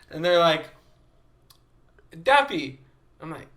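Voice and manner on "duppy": high, loud mimicked kid; kids insisting. Little kids repeating it to the visitor, insistent high voice.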